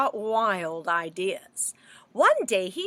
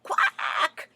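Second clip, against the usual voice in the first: a breathy mock quack; storybook duck quack; 7 semitones higher than usual. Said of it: "yelled the duck".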